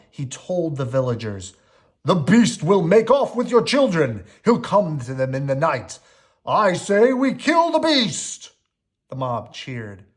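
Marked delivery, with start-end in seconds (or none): 2.0-8.5 loud, higher rabble-rousing villain; furious Gaston. A narrator's reading. Gaston stirring the villagers to kill the beast. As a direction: whip the crowd into a frenzy. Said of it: "Furious his plan had failed".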